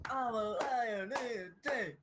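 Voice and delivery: high, slow and hammed-up; mock soundboard voice. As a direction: stretch it out high and silly. Quoting a silly soundboard clip.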